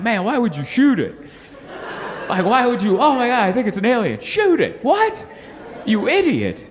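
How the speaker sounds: rising disbelief ending in an exasperated insult. Retold or imitated. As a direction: berate them for shooting the alien. Exasperated rant at the military.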